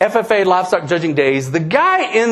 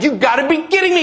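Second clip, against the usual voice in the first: a touch of high, quick mock shock; quick and higher, lightly acting astonished; feigned disbelief; 7 semitones higher, faster than usual. Driver playing innocent to the frightened manager, feigned disbelief.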